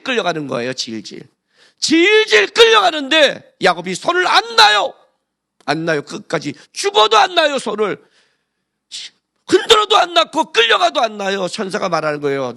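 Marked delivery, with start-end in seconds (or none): 1.8-11.5 loud, high, impassioned sermon climax; full-force pulpit preaching; fervent preaching. Acted. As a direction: preach it with rising fire.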